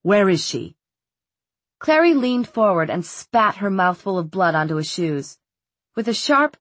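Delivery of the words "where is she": frowning. A narrator's reading. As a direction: demand it with a frown.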